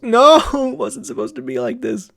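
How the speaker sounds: laughing echo. Retold or imitated. Viewer echoing Shiki's despair, laughing and high. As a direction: echo the line through a pained laugh.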